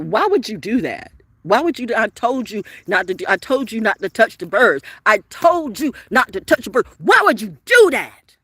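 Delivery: put-on high, loud scolding of the kids; reliving a furious telling-off; retold scolding. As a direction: chew the kids out again as you tell it. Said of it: "first time I'm cussing the kids out".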